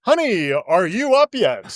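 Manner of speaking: hammed-up reporter voice used on a sleepy spouse; stilted newsmagazine voice. Reporter greeting his wife in the morning, mock stilted TV voice. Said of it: "Why is he talking like this?".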